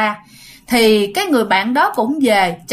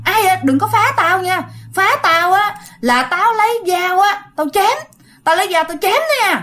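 scared yelling threat; 8 semitones higher than usual. Retold or imitated. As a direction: yell to scare off whatever's outside. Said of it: "bạn mới la làng lên". (la làng, giọng cao, hăm dọa trong sợ hãi)

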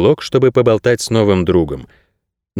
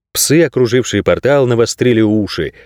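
ordinary delivery

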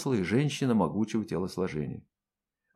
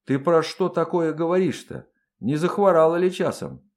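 imploring, raised-pitch questions; pleading; 5 semitones higher, 4 dB louder than usual. A narrator's reading. Colonel asking whether the empress is ill, pleading. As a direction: plead in bewildered concern. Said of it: "взмолился Андрей".

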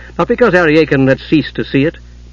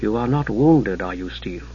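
grave; 4 semitones lower, 7 dB quieter than usual. A narrator's reading. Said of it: "she looked grave".

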